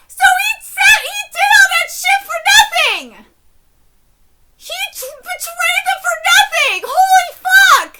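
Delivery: high, screamed disbelief; real furious outcry, voice way up; outraged shouting. Real, not acted. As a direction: rant at full volume over a pointless betrayal. Outraged reaction to betrayal.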